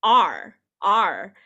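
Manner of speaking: "R" sounded out on its own, firmly; pronunciation demo. Shown as a demonstration. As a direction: say a clear, isolated "R" as a teacher. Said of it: "how to pronounce this one".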